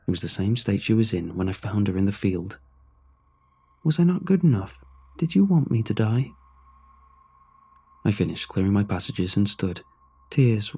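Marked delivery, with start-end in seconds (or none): 3.8-6.3 high, rising hurt plea; hurt pleading. Acted. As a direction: voice climbs high with wounded disbelief. Ghost wife pleads in hurt.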